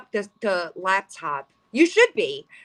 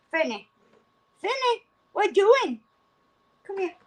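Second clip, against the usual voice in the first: much higher cutesy pet voice; 10 semitones higher than usual. Real, not acted. Talking baby talk to cat.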